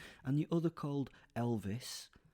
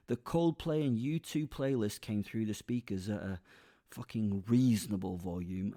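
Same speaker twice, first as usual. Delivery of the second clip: ordinary delivery, nothing marked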